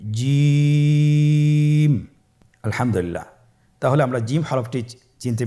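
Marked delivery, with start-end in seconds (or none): 0.1-2.0 জিম held very long, louder; long loud jim. Shown as a demonstration. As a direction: sing out জিম slowly and loud. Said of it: "আবার বলুন". (জিম জোরে লম্বা টেনে)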